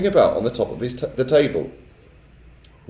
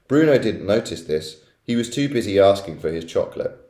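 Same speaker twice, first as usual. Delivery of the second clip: usual speaking voice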